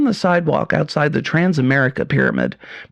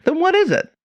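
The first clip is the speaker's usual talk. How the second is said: sergeant voice. Acted. Sergeant demands answer.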